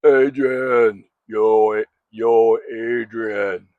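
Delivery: Stallone impression. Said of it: "Let's see if we can try it again".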